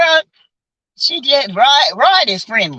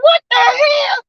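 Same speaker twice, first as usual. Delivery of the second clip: high, stunned what the hell; shocked disbelief, pitched way up; slower than usual. Retold or imitated. Retelling a neighbor's rudeness, stunned high outburst. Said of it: "I'm like".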